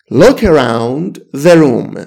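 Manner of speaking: very slow, words spaced out; slow classroom demo; slow model phrase. Shown as a demonstration. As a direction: say it slowly for students. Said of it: "teacher models the phrase".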